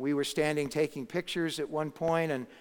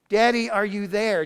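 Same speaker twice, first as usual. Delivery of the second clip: put-on higher, louder little-girl call; 5 semitones higher, 7 dB louder than usual. Little girl calling her daddy, lightly voiced. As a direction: echo the tiny girl's call. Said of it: "loudest, littlest voice".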